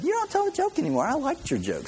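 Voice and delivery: high, fast falsetto mimic; playful high-voiced impression of a friend; quoting Riggs. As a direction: squeak it out like a teasing lady. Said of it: "Riggs said".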